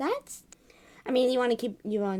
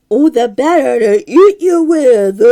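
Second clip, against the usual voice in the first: big loud slow wolf roar; the wolf finally roars at the girl, loud and slow; 14 dB louder, slower than usual. Acted. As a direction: bellow it to scare the girl. Wolf's big reveal.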